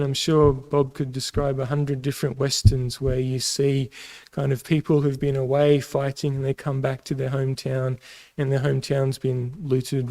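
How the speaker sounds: ordinary delivery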